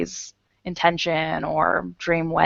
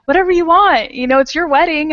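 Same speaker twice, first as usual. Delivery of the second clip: husband voice; 8 semitones higher, 5 dB louder than usual. Retold or imitated. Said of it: "He's like".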